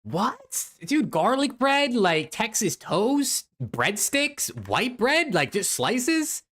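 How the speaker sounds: high, drawn-out disbelief; incredulous. Real, not acted. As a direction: list each bread as a baffled question, slowly.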